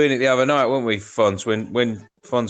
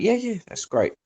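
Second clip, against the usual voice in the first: cheerful approval; 10 semitones higher, 3 dB quieter than usual. Retold or imitated. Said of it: "He went".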